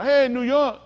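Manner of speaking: playful loud hail; calling out. Acted. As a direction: hail someone by a nickname. Calling out a nickname.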